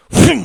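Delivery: loud, high vocal whoom; whooshing impact noise. Acted. Game master voicing blows on the body, loud whoom. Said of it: "his body is getting whoom".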